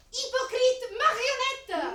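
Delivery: loud, drawn-out insult; angry yell. Acted. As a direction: spit the insults slowly. Helena insults Hermia in quarrel.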